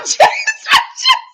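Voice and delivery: high squealing laugh through the words; can't get the words out for laughing; laughing disbelief. Real, not acted. Reacting to the finale, laughing in disbelief.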